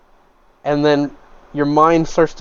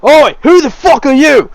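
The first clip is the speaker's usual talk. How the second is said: much higher, louder furious yell; angry tavern brute; 12 semitones higher, 8 dB louder than usual. Acted. Cut-up patron grabs the stranger by the collar, loud angry shout. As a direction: bellow at the intruder like a soaked bar brawler. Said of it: "He's like".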